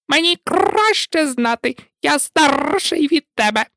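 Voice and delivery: high, huffy parrot voice; sulky parrot. A narrator's reading. Lory arguing with Alisa over age, sulky high insistence. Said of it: "врешті надувся і твердив лише одне".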